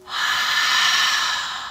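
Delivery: foggy open-mouth exhale; ha breath out the mouth. Shown as a demonstration. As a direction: breathe out like fogging a window. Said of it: "You're going to go".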